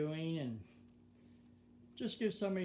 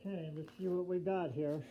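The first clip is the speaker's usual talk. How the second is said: muffled behind mask; 13 dB louder than usual. Real, not acted. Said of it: "hard to hear me with the mask on".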